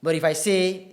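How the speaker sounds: normal talking voice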